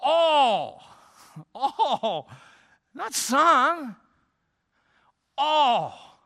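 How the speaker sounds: All punched high and loud, each one alone; emphatic. Acted. Preacher stressing all believers, emphatic high shouts. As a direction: hammer All and Not some, high and loud. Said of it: "It's an emphatic".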